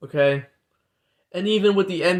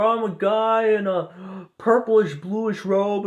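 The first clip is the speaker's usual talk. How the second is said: higher, slow put-on voice of a scheming being; sweetly lure, pitched up and drawn out; mock lizard being; 6 semitones higher, slower than usual. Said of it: "the lizard beings are like".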